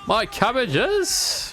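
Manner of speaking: high, slow anguished wail; cabbage merchant wail. Retold or imitated. Avatar cabbage meme quote.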